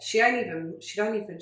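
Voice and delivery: normal talking voice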